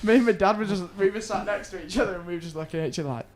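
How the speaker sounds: ordinary talk, nothing marked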